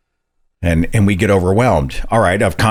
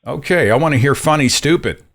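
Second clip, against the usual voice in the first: perking up; 8 semitones higher than usual. Real, not acted. Host perks up at caller.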